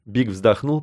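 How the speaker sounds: usual speaking voice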